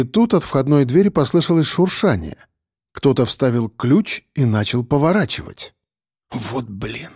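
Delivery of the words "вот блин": very soft, hushed oath; exhaled curse. A narrator's reading. Narrator hears a key in the lock, hushed exhaled curse. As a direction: let the curse out under your breath. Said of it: "выдохнул я".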